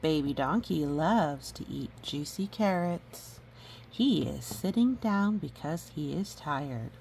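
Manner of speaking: plain reading voice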